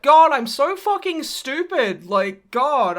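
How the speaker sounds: drawn-out, acted frustration at yourself; self-berating. Acts out Bruce berating himself.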